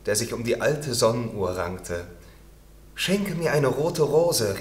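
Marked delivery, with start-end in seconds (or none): 2.9-4.6 slow, higher, louder plea; earnest storybook call. A narrator's reading. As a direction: call up to the rosebush pleading. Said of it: "rief sie".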